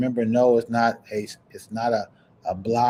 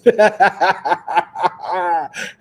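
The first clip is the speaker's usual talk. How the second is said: loud, high burst of laughter; hearty laugh; 7 semitones higher, 6 dB louder than usual. Real, not acted. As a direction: laugh out loud in a long cackling run. Hearty laugh at her own story.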